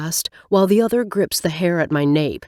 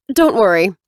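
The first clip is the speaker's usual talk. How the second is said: louder, curt snap; snapping; 3 semitones higher, 5 dB louder than usual. Acted. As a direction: bite the words off, embarrassed and cross. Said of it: "she snaps".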